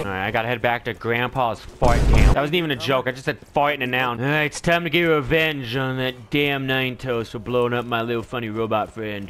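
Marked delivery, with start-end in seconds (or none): none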